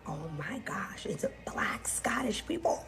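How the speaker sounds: hushed, breathy whisper of amazement; excited whisper. Retold or imitated. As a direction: whisper it like you can't believe your eyes. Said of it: "Everybody is like".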